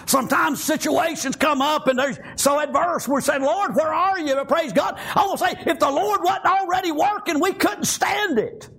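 fervent preaching. Real, not acted. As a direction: preach it with conviction to the congregation. Preaching with force.

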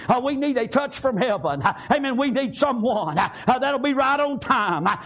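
rhythmic, driving revival preaching; full-force country revival sermon; fervent preaching. Real, not acted. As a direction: preach it in chanted bursts with gasped breaks.

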